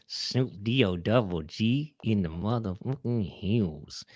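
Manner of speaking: slow, soft rapper drawl. Acted. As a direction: spell it out slowly like a laid-back rapper. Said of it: "[name], and then say drill sergeant".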